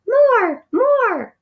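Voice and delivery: high, loud squeaky demand; demanding little mouse. A narrator's reading. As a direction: squeal it like a greedy tiny mouse. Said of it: "demanded the passenger".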